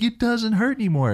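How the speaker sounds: high, excited child's voice, lightly played; a kid thrilled the pain is gone; amazed kid. Kid amazed his arm healed.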